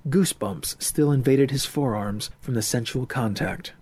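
plain reading voice

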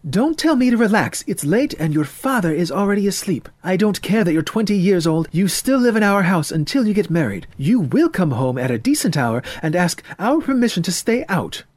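high, insistent mother voice, indignant; scolding mother. Acted. Mother scolds son for coming home late, indignant. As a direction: lay down the law as a cross mother.

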